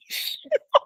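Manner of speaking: a short high laugh of embarrassment; high embarrassed laugh. Real, not acted. Laughing at her friend's teasing about heights, high and flustered.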